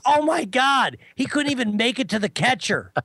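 high-pitched mock incredulity; lightly hammed-up hysterical exclaiming; imitated hysterical amusement. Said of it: "he starts laughing hysterically".

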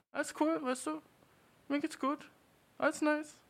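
high, breezy I'm-totally-fine voice; fake fine. Man brushing off crying to his bro in a high, breezy voice. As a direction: pretend all is well in a high chirp. Insisting he's fine again.

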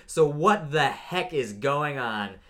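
slow, drawn-out, fed-up; slow disbelieving final jab; slow exasperated question. Real, not acted. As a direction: spell out the disbelief slowly. Closing the rant.

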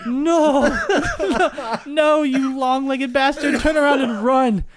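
high, slow, drawn-out mock cry; mock desperate plea. Handcuffed teller willing friend to flee, high drawn-out mock plea. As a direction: comically beg someone to turn back. Silently pleading with returning friend.

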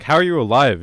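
a mock-astonished question; incredulous. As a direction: demand it in shock. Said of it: "Walter's like".

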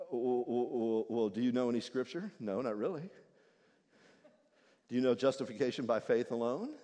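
low, probing questions; quizzing angel. Acted. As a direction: test him like a clerk.

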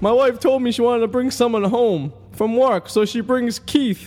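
high, slow Guido accent, hammed-up; Guido plumber act. Comic continuing the plumber routine, in a high, slow, hammed Guido accent. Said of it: "I'll be Tony the plumber".

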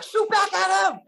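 very high, loud mock-desperate yell; urgent shout. Storyteller urging a frozen soldier to fight, urgent shout. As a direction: yell it like an order under fire.

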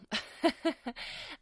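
self-mocking giggle; breathy run of giggles; laugh. Real, not acted. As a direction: giggle at your own expense. Laughs after naming herself a disaster.

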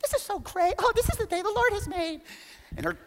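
very high, sing-song gushing; mock cheerful wife. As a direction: gush like a joyful runner. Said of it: "She's like".

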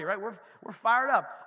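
ordinary delivery

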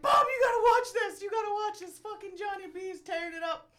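calling out excitedly from the living room; excited shout. Retold or imitated. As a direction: shout it, thrilled. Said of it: "I'm like".

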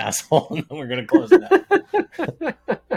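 high laughing voice. Real, not acted. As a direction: laugh through the words. Jokes about editing, laughing.

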